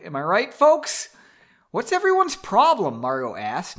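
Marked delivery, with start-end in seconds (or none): 1.7-3.0 high, louder annoyed question; angry. A narrator's reading. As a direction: snap it with raised pitch and volume. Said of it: "now angry".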